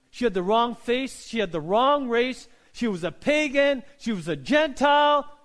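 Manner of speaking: loud, high, rhythmic list; full-force preaching. Real, not acted. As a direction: hammer each point, climbing in pitch and volume.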